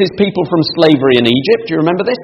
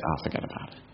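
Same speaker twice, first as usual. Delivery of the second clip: dismissive whisper; 12 semitones lower, 21 dB quieter, faster than usual. Retold or imitated. Voicing dismissive rejection of scripture.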